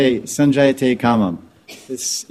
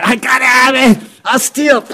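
mock mad shout; 11 semitones higher, 4 dB louder than usual. Mad craving man voiced.

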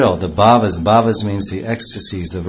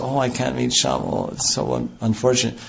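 soft, sorrowful lament; a touch of weeping in the words; lamenting Radharani; 2 semitones higher, 6 dB quieter than usual. Retold or imitated. Radharani alone in her room, mild lament. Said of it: "she says".

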